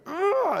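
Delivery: loud, high frustrated grunt; angry toddler grunt. Retold or imitated. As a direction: grunt like a furious three-year-old swinging. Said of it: "she's like".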